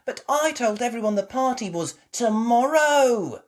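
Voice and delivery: put-on high, loud protesting child; upset little pig. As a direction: whine it like a worried little girl. Peppa protests to Mummy.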